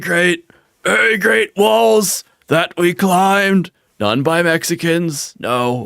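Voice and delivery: hammed-up politician with staccato bursts and Valley Girl lilt; Trump. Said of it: "Christopher Walken plus Valley Girl accent plus".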